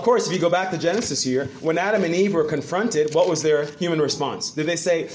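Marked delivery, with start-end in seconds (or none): none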